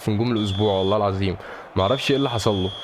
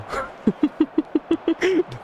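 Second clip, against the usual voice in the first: ضحكة رفيعة. Real, not acted. Laughs at the game.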